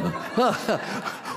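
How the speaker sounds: quick gleeful chuckle; short delighted laugh. Real, not acted. Preacher to congregation, gleeful laugh. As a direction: laugh at the devil in the sawdust.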